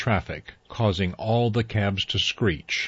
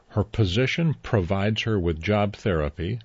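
usual speaking voice

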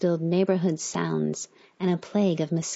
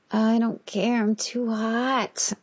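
higher, drawn-out whine; whining complaint; 5 semitones higher, slower than usual. A narrator's reading. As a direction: complain petulantly about the heat. Overheated woman complaining in bed.

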